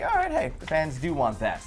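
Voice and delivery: normal talking voice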